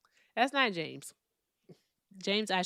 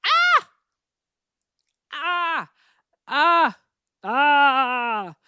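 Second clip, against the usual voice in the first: loud high screams of disgust; frustrated screams; 7 semitones higher, 11 dB louder, much slower than usual. Real, not acted. Reacts to caller's comment.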